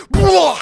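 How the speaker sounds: loud, high cut-off yelp; mock startled yelp. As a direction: cry out as if the tooth got yanked. Retold tooth yanked by door.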